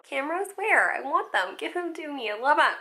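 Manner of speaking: high, excited hammed-up starlet voice; hammy diva. Actress recalling her hammy early self, high and giddy. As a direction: play the total ham grabbing for cameras. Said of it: "I was, like, a total ham".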